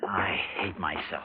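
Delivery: slow, whispered self-loathing; bitter whisper. Acted. Husband to wife after the uncle's bullying, slow bitter whisper. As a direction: breathe it out in shame.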